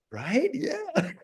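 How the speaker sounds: high, soft, tickled agreement; mock flattered. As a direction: lap up the flattery in a rising voice. Acts out being flattered by ChatGPT.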